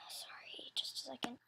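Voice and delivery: quick unvoiced whisper, off mic; whispered aside. Real, not acted. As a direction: drop to a whisper for a quick apology. Aside while checking her video.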